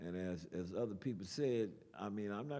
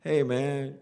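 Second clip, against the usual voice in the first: loud, higher imitation of a stranger hailing someone; calling out; 5 semitones higher, 14 dB louder than usual. Big guy with a chair hails the poet, calling out. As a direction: holler to get his attention.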